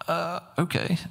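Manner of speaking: a high, hesitant uh-okay; unsure and a bit self-conscious in front of the angels; uneasy uh okay. Retold or imitated. Retelling a self-conscious moment before watching angels, hesitant.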